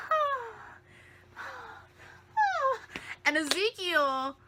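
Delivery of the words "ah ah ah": high breathy swooning ahs; mock swooning sighs. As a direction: swoon like a smitten girl, much higher. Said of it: "she's like".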